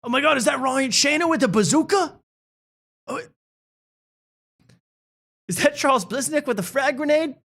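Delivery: high, thrilled put-on voice; mock excited viewer. Bored viewer gushes at action scenes, high and excited. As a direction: gush over each action moment. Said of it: "then I'll go".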